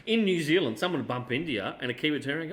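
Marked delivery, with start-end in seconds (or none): none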